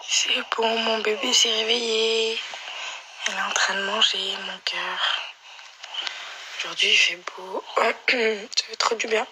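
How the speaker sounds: hoarse, cracked voice; speaking through a hoarse throat. Real, not acted. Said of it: "j'ai la voix cassée".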